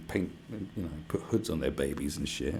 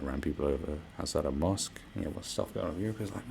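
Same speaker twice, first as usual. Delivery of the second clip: ordinary talk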